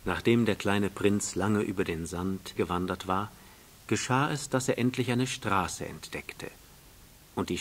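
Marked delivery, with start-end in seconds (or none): none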